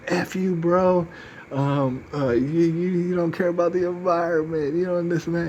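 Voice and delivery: high, heated mock rant; imitated angry rant. Offended guy accusing the speaker, high heated mock rant. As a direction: play the offended guy lecturing him. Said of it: "He was like".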